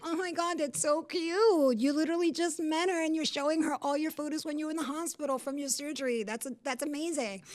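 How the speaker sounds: high, sarcastic mock admiration; smart-ass gushing. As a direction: lay on fake delight, drawing out the praise. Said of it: "I'm a smart ass".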